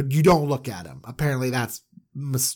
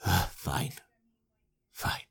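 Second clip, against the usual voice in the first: weary defeated father; 5 semitones lower, 6 dB quieter than usual. Retold or imitated. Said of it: "And he's like".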